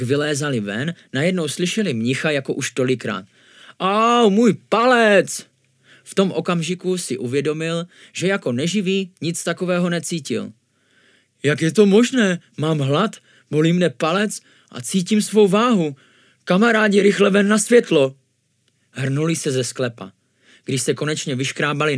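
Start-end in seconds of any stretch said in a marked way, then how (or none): 3.8-5.5 loud, high, drawn-out ow; cry of pain. A narrator's reading. Monk yelps at hurt thumb. 11.4-18.2 high, loud excited discovery; astonished urgency. A narrator's reading. Monk astonished, urges friends out.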